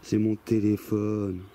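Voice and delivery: deep slow ghostly voice. Retold or imitated. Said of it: "une grosse voix qui sort de nulle part".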